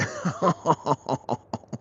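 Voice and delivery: high giggling cackle; real laughter. Real, not acted. Rancher after scolding cow Jenny Lane, high giggling laugh. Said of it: "I'm sorry for laughing".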